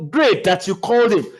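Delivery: ordinary talk, nothing marked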